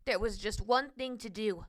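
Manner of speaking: ordinary delivery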